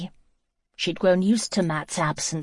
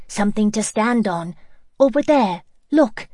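excited; 3 semitones higher, 5 dB louder than usual. Acted. Girl pointing out the log.

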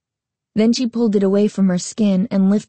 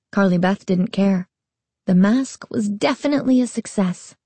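ordinary delivery, nothing marked

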